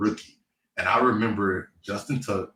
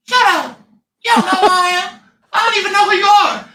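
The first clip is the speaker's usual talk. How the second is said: very high, loud squeaky retort; mock a big lineman with a squeaky voice; high-pitched voice; 11 dB louder than usual. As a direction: lineman snapping at a rookie in a squeaky voice. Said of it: "the most high-pitched voice that you've ever heard".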